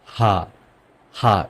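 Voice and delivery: German letter name হা, an open 'haa'. Shown as a demonstration. Teacher showing how Germans name H, হা as 'haa'. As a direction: say হা as 'haa', not 'aitch'. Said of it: "এইচ কে বলবো".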